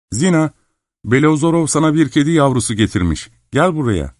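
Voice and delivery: high-pitched call to a daughter. A narrator's reading. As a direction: call her in from another room. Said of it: "kızına seslendi". (yüksek perdeden seslenerek)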